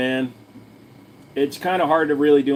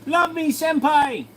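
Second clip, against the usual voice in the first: put-on much higher, slow, sugary anime-girl plea; cutesy anime fan voice; slower than usual. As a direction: squeal it like an adoring anime fan. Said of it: "acknowledges me and says".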